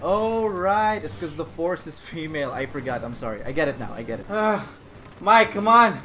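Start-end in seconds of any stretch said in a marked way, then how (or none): 1.0-4.2 low, hushed mock contrition; sarcastic apology. Player mocking Rey's overpowered ability, quiet sarcasm.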